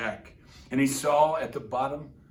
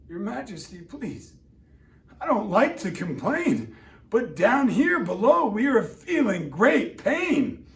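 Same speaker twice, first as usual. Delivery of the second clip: pained, pleading little turtle; groaning plea; 5 semitones higher, 5 dB louder than usual. Acted. Mac groaning up to the king, asking for rights. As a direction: beg for relief through the pain. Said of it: "groaned Mac".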